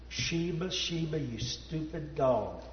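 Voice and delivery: soft, broken-up crying over the dog; tearful lament. Retold or imitated. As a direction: grieve quietly through tears. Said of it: "I started crying and saying".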